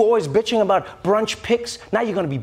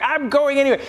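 higher, defiant mock Nancy; 6 semitones higher than usual. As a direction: toss it off, refusing to listen. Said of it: "Nancy's like".

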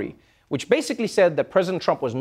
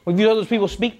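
normal talking voice

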